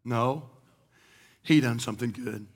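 quiet, weighty no; low correction. Acted. Preacher turning the boast back to Christ in a low voice. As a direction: drop low and soft to correct them.

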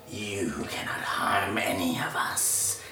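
slow, menacing whisper; sinister creature whisper. Acted. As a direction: whisper it slowly like a creature's warning. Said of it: "the hand looks at you, it's like".

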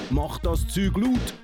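ordinary talk, nothing marked

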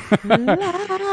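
squealing giggle, high; high laugh. Real, not acted. Host laughs mid-question.

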